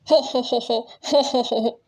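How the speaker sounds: a lightly voiced, spoken ho-ho laugh; mock the friend with a put-on chuckle. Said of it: "मैं अपनी हंसी नहीं रोक पाया".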